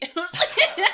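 high bursts of laughter; laughing. Real, not acted. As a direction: crack up mid-sentence. Laughing at own mishearing.

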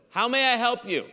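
high, loud service greeting; helpful store clerk. Retold or imitated. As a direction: put on an eager shop-assistant voice. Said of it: "is my standard answer".

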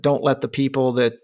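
ordinary delivery

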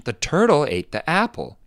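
high, loud "turtle" and "apple", dipping on "ate"; intonation demo. Shown as a demonstration. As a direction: lift both nouns, drop the verb "ate". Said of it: "There's a drop in intonation".

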